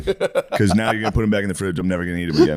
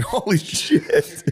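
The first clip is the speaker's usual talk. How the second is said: slow amazement; 7 semitones higher, much slower than usual. Retold or imitated. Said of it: "I'll see it and be like".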